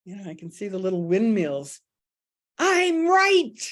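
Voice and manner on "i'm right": much higher, loud cry of I'm right; a hammed-up crusader; mock indignant claim. Mock knight charging.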